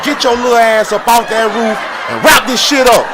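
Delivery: loud high laughing yell. Real, not acted. Yelling at the roofer to get off the roof. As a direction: holler it up at the roof.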